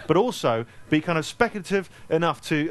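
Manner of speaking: ordinary delivery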